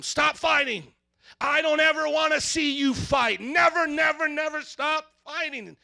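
loud, high scolding shout; parent yelling at kids. Retold or imitated. Parent yelling at fighting kids, loud scolding. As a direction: yell at the kids to quit it. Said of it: "I may say to them".

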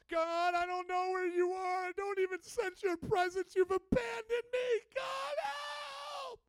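panicked, high wailing plea, drawn out; freaking-out prayer. Acted. Freaked-out believer crying out to God, wailing in panic. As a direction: climb into a loud, desperate cry for help. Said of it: "God can take your freakouts".

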